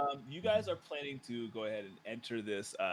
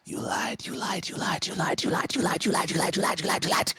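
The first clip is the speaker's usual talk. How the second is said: frantic accusation, louder and faster; possessed blade's voice; 6 semitones higher, 7 dB louder than usual. Acted. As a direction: build into a rapid, harsh chant. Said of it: "you h-, hear the voice say".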